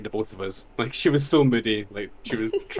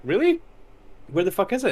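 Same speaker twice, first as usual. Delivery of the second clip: shocked disbelief; 8 semitones higher than usual. Retold or imitated. Shocked reply to neighbor.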